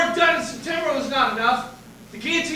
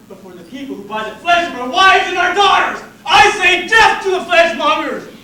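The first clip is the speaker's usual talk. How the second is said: rabble-rousing shout; 4 semitones higher, 9 dB louder than usual. Acted. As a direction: build to a loud cry of "death". Rabble-rousing speech to crowd.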